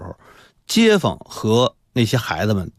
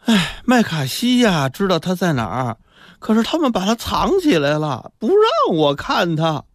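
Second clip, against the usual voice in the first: plaintive mother; 7 semitones higher than usual. Acted. Confused mother keeps lamenting missing son.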